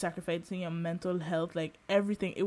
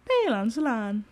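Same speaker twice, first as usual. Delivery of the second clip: higher, louder, mock public-transport announcement voice; annoying tram announcer lady; 6 semitones higher, 5 dB louder than usual. Mocking the tram's recorded announcer, higher and louder announcement voice. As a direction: imitate the tinny automated tram lady. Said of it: "them annoying lady voices on the trams".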